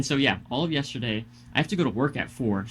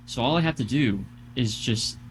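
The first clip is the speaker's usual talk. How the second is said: ordinary delivery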